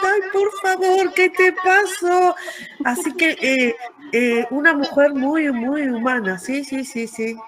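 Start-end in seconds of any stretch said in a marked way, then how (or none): 0.1-2.4 high, drawn-out mock concern; imitated worried concern. Author to the tearful fan, imitated as worried. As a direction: imitate someone fussing, high and slow. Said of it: "ella toda preocupada dice".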